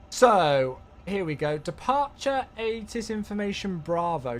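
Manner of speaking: ordinary delivery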